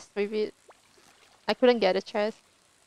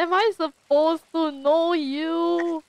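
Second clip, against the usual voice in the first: high, slow, drawn-out comeback; mock incredulous retort; 6 semitones higher, much slower than usual. Streamer retells answering the lady, mock incredulous. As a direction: throw it back, slowly and higher. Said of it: "I just look at her like".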